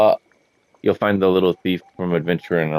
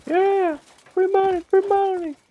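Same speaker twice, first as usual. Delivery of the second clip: much higher, squealing cheer; ecstatic squeal; slower than usual. Real, not acted. Gamer finding free coins on the ground, ecstatic high squeal. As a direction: squeal it like you hit the jackpot.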